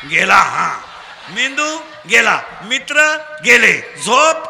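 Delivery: loud, projected rallying of a crowd; call-and-response preaching. Real, not acted. Preacher drilling children for loud answers, call-and-response preaching. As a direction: throw each phrase out for the crowd to finish.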